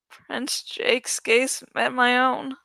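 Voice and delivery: usual speaking voice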